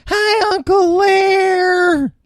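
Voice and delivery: put-on very high, slow, shouted kid greeting; little Tommy. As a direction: stretch out a squeaky little-boy hello. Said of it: "little snot-nosed transgender Tommy".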